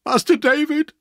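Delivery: high, loud anguished cry; distraught man breaking down; wild sobbing. A narrator's reading. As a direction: sob the name out in desperation. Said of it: "he sobbed wildly".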